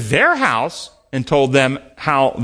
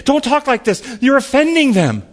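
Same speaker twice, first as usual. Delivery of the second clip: worried disciples; 9 semitones higher than usual. Retold or imitated. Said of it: "his disciples actually say".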